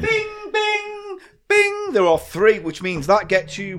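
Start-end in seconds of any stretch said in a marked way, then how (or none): 0.0-1.9 high sing-song chime. Acted. Game show correct answer chime.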